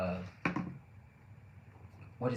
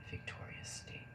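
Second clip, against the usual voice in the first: whisper; 9 dB quieter than usual. Real, not acted. Repeating the state to chat.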